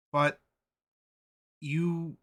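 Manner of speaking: usual speaking voice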